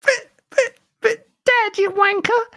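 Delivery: very high, mock-tearful little boy; hammed-up whiny kid. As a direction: whimper in a put-on child falsetto. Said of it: "it's my only kid voice I've got".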